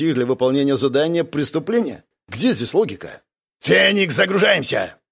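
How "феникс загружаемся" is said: high, loud command; commanding order. A narrator's reading. Instructor calls the squad to board, commanding. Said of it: "приказал Карс".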